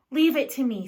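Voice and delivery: loud, eager offer. Acted. Younger sister offering help, loud and eager. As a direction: jump in keenly.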